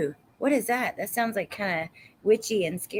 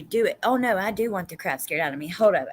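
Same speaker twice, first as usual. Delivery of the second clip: ordinary talk, nothing marked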